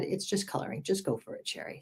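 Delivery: soft whispered quote of a friend; retelling a friend's advice softly; hushed quote. Retold or imitated.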